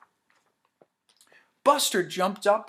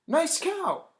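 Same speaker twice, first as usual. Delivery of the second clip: friendly classmate; 11 semitones higher, 4 dB louder than usual. Acted. Classmate praising drawing.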